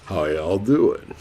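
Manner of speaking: low, slow Southern drawl; Southern accent. Retold or imitated. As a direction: imitate a deep Southern accent. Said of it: "like, a very Southern accent".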